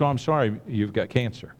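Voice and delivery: soft, low, grave news; somber diagnosis. Retold or imitated. Doctor telling patient of cancer, soft and low. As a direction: deliver bad news quietly. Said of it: "you get the announcement in the doctor's office".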